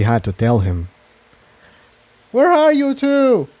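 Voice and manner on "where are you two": high character voice calling out in the dark hall; calling shout. Acted. Jigsaw searching the dark hall, calling out. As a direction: shout for the two lost mares. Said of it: "Jigsaw shouted".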